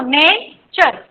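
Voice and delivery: Nature slowly split as ने, चर; syllable by syllable. Shown as a demonstration. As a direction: pause between ने and चर. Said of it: "ये टू syllable word है". (Nature दो टुकड़ों में, रुककर)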